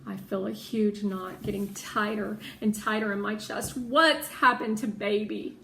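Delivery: plain reading voice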